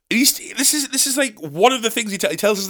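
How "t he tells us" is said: ordinary talk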